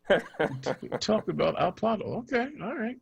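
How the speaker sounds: talking through laughter; laughing. Real, not acted. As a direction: laugh while protesting. Laughing at co-host's jab.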